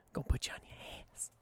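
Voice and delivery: soft whispered warning. Real, not acted. Guest warning listeners about strong weed, whispered. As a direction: whisper it like a secret.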